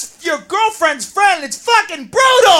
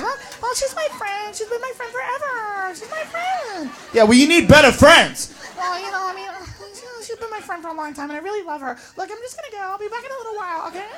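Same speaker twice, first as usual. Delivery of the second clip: put-on timid girlfriend; 3 semitones higher, 6 dB quieter than usual. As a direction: tiptoe around the jealous boyfriend. Girlfriend pleading softly with boyfriend.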